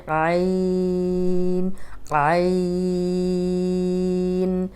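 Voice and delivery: عین held long, tongue pressed back to the throat; drawn-out ain. Shown as a demonstration. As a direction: model the throaty عین for students. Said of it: "حلق کی طرف پیچھے دبانا ہے".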